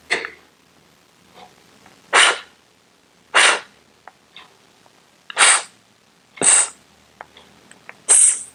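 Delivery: each unvoiced sound whispered on its own; isolated sounds. Shown as a demonstration. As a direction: breathe out each consonant, no voice. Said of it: "we have the sounds".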